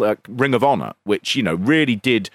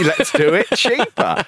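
slow, high, laughing repeat; laughing echo; 7 semitones higher, slower than usual. Retold or imitated. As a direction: repeat it through a laugh, drawn out. Echoes the quote, laughing.

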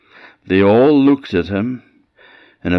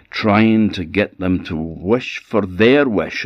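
ordinary delivery, nothing marked

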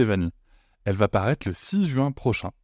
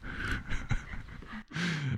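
breathy laugh; 8 semitones higher, 9 dB quieter than usual. Real, not acted. Breaking out of ASMR bit.

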